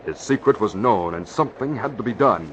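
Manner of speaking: ordinary talk, nothing marked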